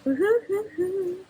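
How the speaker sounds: playful high whoop; happy, high-pitched woo-hooing; high sung woo-hoo. Real, not acted. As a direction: let out a long, lilting woo-hoo. Carries on singing happily.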